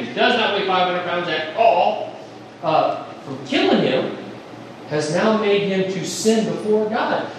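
normal talking voice

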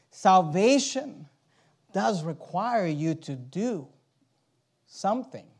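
ordinary delivery

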